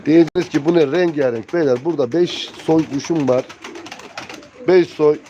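ordinary talk, nothing marked

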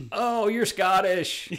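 high, loud, drawn-out mock realization; mock reaction. Replying to Scottish hecklers, high mock amazement.

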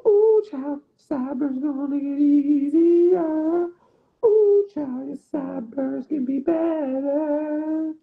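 high, slow, drawn-out sassy auntie. Pretend. Consoling Shaq about his sideburns, high sassy auntie voice. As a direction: coo it like a fussing auntie.

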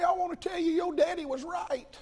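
a hint of an aged preacher's high voice; old preacher confessing. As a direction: lightly voice the old man's confession.

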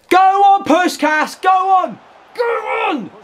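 high, loud cheering on; will him forward at the top of the voice; high yells of encouragement; urging shout. Real, not acted. Urging player toward goal.

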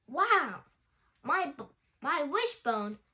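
high, loud amazed cry; excited wow. Acted. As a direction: burst out in delight. Giant bone appears in sky.

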